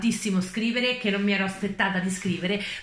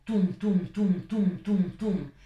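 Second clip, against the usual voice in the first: a light mock heartbeat, tum tum with a falling tune; heartbeat imitation; 2 semitones lower, much slower than usual. Vampire teasing Charlie about her racing heart, mock heartbeat sounds. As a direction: tap out the heartbeat with your voice, gently. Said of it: "Il tuo cuore batte fortissimo".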